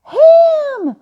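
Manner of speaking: "Ham!" squeaked very high and loud; her high "Ham!". Retold or imitated. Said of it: "It was always".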